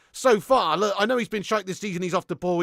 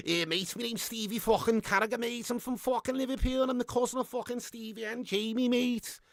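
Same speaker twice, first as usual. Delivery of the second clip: hammed-up Scouse impression; Scouse accent; 2 semitones higher than usual. Liverpudlian bragging about famous cousins, hammed-up Scouse. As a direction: put on a thick Liverpool accent. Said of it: "That's fucking scouse, that".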